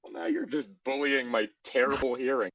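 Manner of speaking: usual speaking voice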